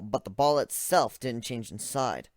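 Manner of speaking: usual speaking voice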